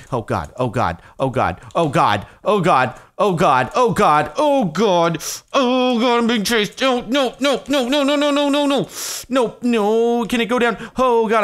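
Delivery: building from mutters to loud, high, frantic cries; real panic as the monster closes in; panicked yelling. Real, not acted. Streamer chased by a mob in the mine, escalating panicked screams.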